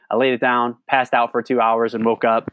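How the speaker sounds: normal talking voice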